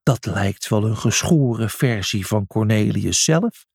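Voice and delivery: ordinary delivery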